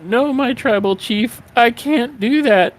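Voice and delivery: very high, strained mock wail without much volume; imitated shriek. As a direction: squeal it out like a cornered underling. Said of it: "shrieks out".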